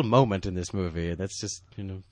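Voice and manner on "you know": ordinary talk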